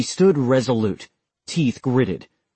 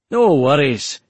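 put-on Scottish accent; 3 semitones higher, 5 dB louder than usual. A narrator's reading. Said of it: "in a thick Scottish accent".

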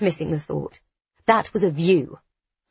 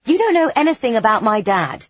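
sharp, loud and higher; lashing out; 5 semitones higher, 7 dB louder than usual. A narrator's reading. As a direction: snap back hurt and defensive. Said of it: "I can't help lashing out a little".

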